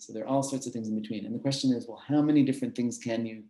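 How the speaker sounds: ordinary talk, nothing marked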